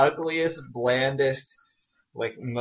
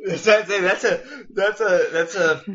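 laughing; 7 semitones higher, 6 dB louder than usual. Real, not acted. Stammering through laughter.

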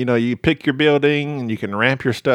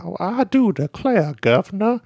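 genteel Southern accent; 8 semitones higher than usual. Shown as a demonstration. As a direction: put on a fancy Southern accent, pitched up. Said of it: "that type of Southern".

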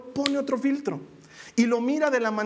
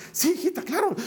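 higher, nervous, hurried yes to her daughter; a mother hiding her panic, pitched up; trembling mother; 7 semitones higher than usual. Retold or imitated. Mother answering daughter's request for water, trembling and pitched up. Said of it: "así temblorosa".

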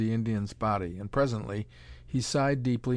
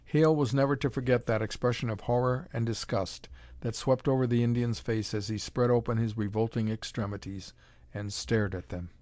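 ordinary delivery

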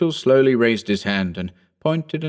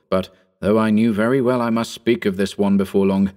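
usual speaking voice

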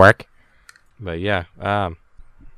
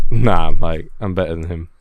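low mock British accent; [name], British accent; 4 semitones lower, 8 dB louder than usual. Claiming to beat the real friend, low mock British accent. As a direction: boast in a lowered British impression. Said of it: "I've been practicing my accent".

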